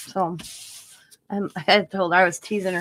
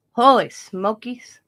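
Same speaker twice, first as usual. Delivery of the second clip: slow, louder amazement; amazed; 3 semitones higher, 8 dB louder, slower than usual. Real, not acted. Watching a painting channel, slow amazed exclaim. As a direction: marvel at the painter's talent.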